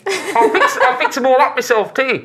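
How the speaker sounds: high, chuffed character voice; proud shopkeeper. Acted. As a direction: boast modestly in a high put-on voice. Shopkeeper boasts of his repairs.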